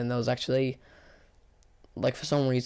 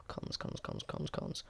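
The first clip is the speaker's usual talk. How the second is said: whispered, much lower and softer; whispering to self; 15 dB quieter than usual. Real, not acted. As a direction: whisper the word over and over while thinking. Whispers while thinking of cons.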